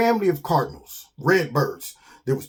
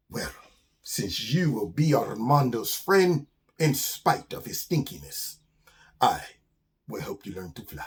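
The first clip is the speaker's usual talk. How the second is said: Armando the grateful skunk; 4 semitones lower, 4 dB quieter than usual. Acted. Skunk offers to teach his new friend flying, solemn. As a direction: pronounce the offer grandly, dropping very soft.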